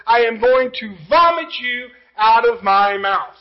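loud, slowed preaching of scripture; fervent sermon delivery; preaching at full force. Acted. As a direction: proclaim it to the congregation, weighing each line. Preacher reads scripture, comments.

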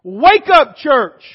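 loud, sharp wake-up call; shouted call. Real, not acted. Preacher calling the sleeping church, loud. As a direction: jolt them awake.

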